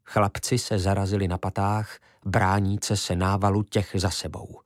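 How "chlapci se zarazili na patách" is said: plain reading voice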